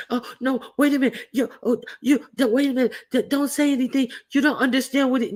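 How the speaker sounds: high, panicky flustered voice; mocking flustered man. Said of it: "you see the little guy over there like".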